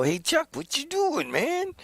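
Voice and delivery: very high, cartoonish greeting; cheery wake-up call. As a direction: squeal it like a chirpy prank caller. Wake him up and go.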